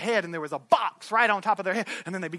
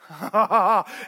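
real laugh; short laugh after the climax; 4 dB louder than usual. Real, not acted. Preacher laughing after a big promise, real laugh.

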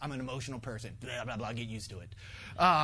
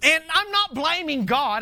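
usual speaking voice

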